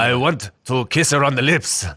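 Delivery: lightly put-on Spanish accent; a hint of a hammed Spanish accent; fake Spanish accent. Co-host trying the boss's line in mock Spanish accent. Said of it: "is that Spanish?".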